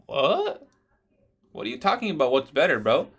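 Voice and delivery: bursting out in incredulity, pitch shooting up; incredulous what. Real, not acted. Mocking a silly chat question.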